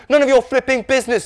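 high, loud retort; irritated rebuff. A narrator's reading. Norm rebuffing Dave's texting question, irritated. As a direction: shut down a nosy brother. Norm snubs nosy brother.

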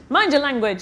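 father thundering at his daughter. A narrator's reading. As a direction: slam the warning down on her. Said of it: "his father thundered".

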